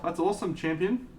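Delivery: praising a viewer's painted model, high and cheery; high excited praise. Real, not acted. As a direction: pitch it up, cheering.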